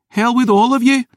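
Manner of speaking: grieving, furious maid; tearful rage. Acted. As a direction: break down while shouting. Isla storms out.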